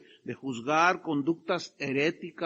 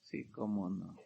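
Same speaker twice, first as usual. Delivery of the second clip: sarcastic; 11 semitones lower, 11 dB quieter than usual. Real, not acted. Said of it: "sarcastic aside".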